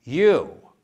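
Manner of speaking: sudden loud, high, hostile bark; hateful angry "You?". Retold or imitated. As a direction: spit it out at him in disgust. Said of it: "she has this hateful, angry look".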